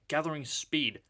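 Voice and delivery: plain reading voice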